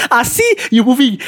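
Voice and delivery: high, through laughter, mocking him; laughing gloat. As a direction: repeat the jab while cracking up. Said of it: "Then I was like".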